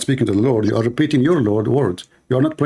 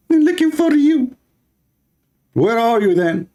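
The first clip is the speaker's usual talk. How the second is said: mocking caller; 12 semitones higher than usual. Taunting the caller's own threat.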